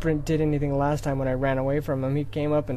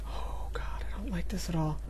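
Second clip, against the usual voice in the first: hushed and tense; frightened whisper; 8 dB quieter than usual. Real, not acted. Scared gamer in a dark wood, whispering. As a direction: whisper your dread.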